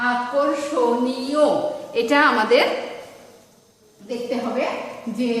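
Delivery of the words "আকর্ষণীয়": আকর্ষণীয় slowly, each syllable distinct; syllable-by-syllable pronunciation. Shown as a demonstration. Teacher putting the sounded-out word back together, syllable by syllable. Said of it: "এখন এটার উচ্চারণ লিখতে গেলে".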